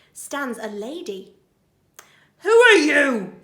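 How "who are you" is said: higher, loud challenge; demanding burglar. Acted. Burglar confronts the intruder, demanding. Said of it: "says Burglar Bill".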